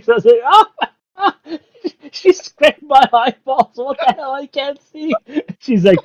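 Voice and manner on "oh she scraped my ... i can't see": high-pitched mock wail through laughter; mock screaming. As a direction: imitate a comic scream of agony, laughing. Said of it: "it's the greatest, like, screaming".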